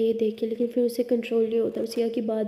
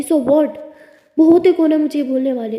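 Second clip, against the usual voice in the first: loud, higher defiant shout; 4 semitones higher, 9 dB louder than usual. Acted. Tara shouts at Siya about her father, defiant yell. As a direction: throw it back at her cousin, yelling. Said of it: "तारा थोड़ा shout कर रही होती है".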